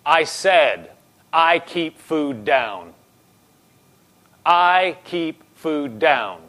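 slow, deliberate, spaced-out words; slow, resolute affirmation; firm declaration. Retold or imitated. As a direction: insist on it word by word. Minister models a firm confession.

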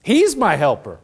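usual speaking voice

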